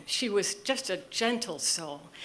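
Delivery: usual speaking voice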